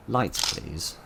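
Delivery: ordinary talk, nothing marked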